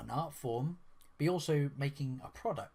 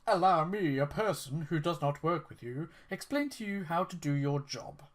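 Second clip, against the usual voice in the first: mock condescending; 2 semitones higher than usual. Quoting the developer's sneering retort to the fan, mock condescending. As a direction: throw his polite words back with a sneer. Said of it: "and she goes".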